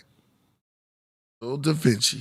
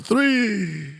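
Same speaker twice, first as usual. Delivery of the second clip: Count voice; 10 semitones higher than usual. Acted.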